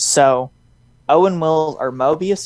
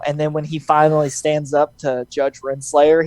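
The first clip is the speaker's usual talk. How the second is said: ordinary delivery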